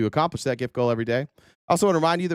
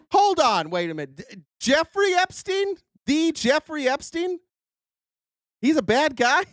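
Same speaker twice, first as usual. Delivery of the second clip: mock shocked fan. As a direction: gasp out the revelation like it's news. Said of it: "Imagine being the person going to that".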